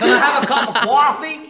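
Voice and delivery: New York accent. Shown as a demonstration. Ordering coffee to teasing friends, mock New York accent. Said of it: "To New Yorkers".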